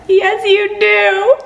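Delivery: high, loud, very slow squeal; gushing contradiction. Acted. Fan insists the hero does care, gushing squeal. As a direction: stretch it out in a loud high coo.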